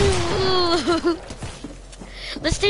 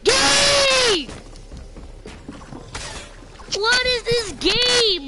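high, drawn-out wailing complaint; exasperated cry; 6 semitones higher, slower than usual. Real, not acted. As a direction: wail it slowly at the game. Frustrated by lag.

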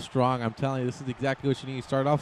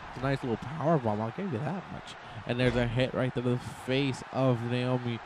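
ordinary delivery

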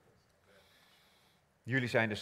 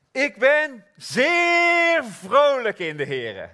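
much higher, louder and slow; joyful exclamation; 13 semitones higher, 12 dB louder, much slower than usual. Acted. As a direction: shout your joy to the hall. Joyful declaration of verse.

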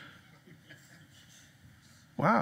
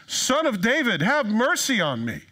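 crying out; 7 semitones higher, 4 dB louder than usual. Acted. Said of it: "he starts crying out for Jesus".

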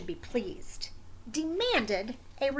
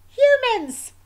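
much higher, louder startled cry; surprised exclamation; 13 semitones higher, 13 dB louder than usual. Acted. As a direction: burst out in surprise. Wombat discovers neighbors.

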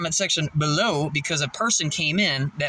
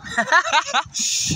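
laugh; 12 semitones higher than usual. Real, not acted. Laughing as video starts.

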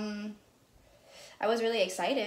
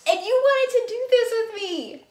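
teasing; 12 semitones higher, 9 dB louder than usual. Real, not acted. Teasing sister, high far voice.

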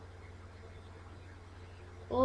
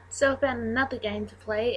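ordinary delivery

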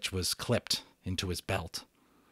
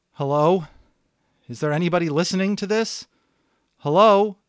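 higher, louder nervous hello into the mic; anxious calling; 8 semitones higher, 8 dB louder than usual. Acted. Boy calling into the radio for help, nervous and raised. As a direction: call out unsure if anyone hears.

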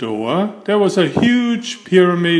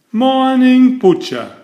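high, much louder cheery greeting; cheerily; 10 semitones higher, 7 dB louder than usual. A narrator's reading. Mister Clumsy greeting the butcher, loud cheery hello. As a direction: sing out a bright hello. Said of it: "he said cheerily".

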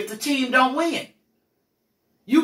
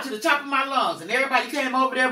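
ordinary talk

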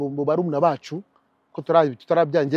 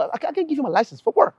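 defiant driver; 10 semitones higher than usual. Retold or imitated. Acted defiant driver to police.

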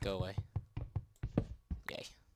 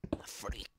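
whispered; 5 dB quieter than usual. Pretend. Whispered joke aside.

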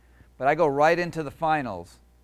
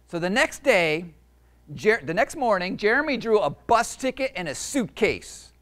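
picture book reading; 5 semitones higher, 4 dB louder than usual. A narrator's reading. Author reading his book to kids, storytelling voice. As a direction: read it to a room of kids.

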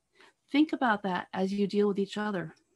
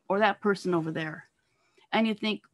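ordinary talk, nothing marked

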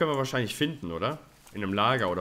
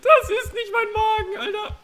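high, laughing through the words; 6 dB louder than usual. Real, not acted. Gamer recapping the jaguar scare with friend, laughing. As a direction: giggle it out.

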